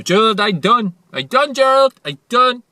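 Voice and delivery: full-voiced, high-pitched yell; shouting. Calling to Gerald out of the window, shouting. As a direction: holler it out at the top of your voice. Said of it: "I shout out the window".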